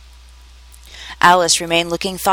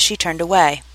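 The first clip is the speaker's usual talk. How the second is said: usual speaking voice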